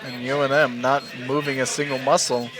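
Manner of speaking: live esports caster read; play-by-play commentary. Acted. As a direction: call the round as it happens. Casting the match live.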